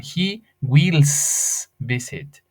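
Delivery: "He wills visit" drawn out very slowly; wrong form, very slow. Shown as a demonstration. Teacher slowly reading a student's wrong homework line. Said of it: "una tarea donde pusieron".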